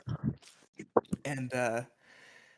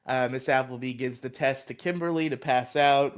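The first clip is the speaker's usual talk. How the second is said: ordinary delivery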